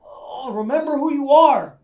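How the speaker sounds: high and loud, urging herself; urgent self-plea. Acted. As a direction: cry out to your own memory.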